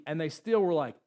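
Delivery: ordinary talk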